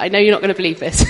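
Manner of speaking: giggling through the friend's words; laughing quote. Retold or imitated. Friend texting from Scotland, laughing. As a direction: laugh while voicing her friend. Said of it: "She's like".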